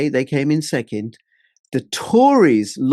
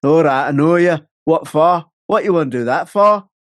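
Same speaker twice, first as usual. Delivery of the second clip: Bradford accent, a bit louder; 3 semitones higher, 5 dB louder than usual. Shown as a demonstration. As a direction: put on a punchy Bradford accent. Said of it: "I can do Bradford".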